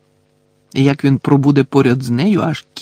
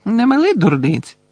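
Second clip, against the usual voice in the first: higher-pitched, offhand dismissal; distracted brush-off in a higher voice; absent-minded; 7 semitones higher than usual. A narrator's reading. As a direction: wave it off absently, voice lifted. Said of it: "неуважно озвалася Герміона".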